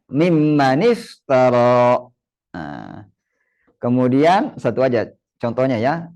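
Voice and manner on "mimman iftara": mimman iftara linked as 'mimmaniftaraa', slowly; joined reading. Shown as a demonstration. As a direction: join mimman iftara with an added i after the n. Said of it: "Nah, disambung dengan". ("mimman iftara" disambung jadi 'mimmaniftaraa', pelan)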